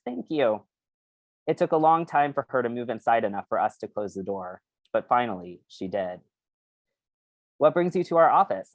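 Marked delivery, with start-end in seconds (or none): 0.0-0.7 high squeaky little thank-you; tiny squeaky creature voice; squeaky brain. Acted. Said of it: "The brain inched forward and squeaked". 7.6-8.7 brusque and businesslike, a bit higher; brusque club president. Acted. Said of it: "I asked brusquely".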